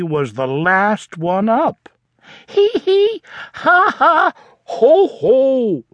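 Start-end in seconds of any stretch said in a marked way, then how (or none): 2.5-5.8 high spelled-out giggles; giggling. Acted. Froggy being tickled with a feather duster, high giggles. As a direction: laugh as if tickled.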